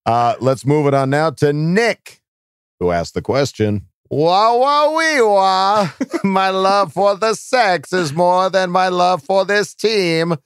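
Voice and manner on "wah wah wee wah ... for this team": put-on high, drawn-out comic Kazakh accent; mock Kazakh accent. Host reading a listener's joke question, mock Kazakh accent. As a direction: play a gleeful fake Kazakh character.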